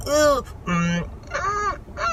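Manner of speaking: hesitant high, soft "mm" hums; uneasy hum. Retold or imitated. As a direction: hum with doubt, voice sliding up high. Acting out her uneasy reaction.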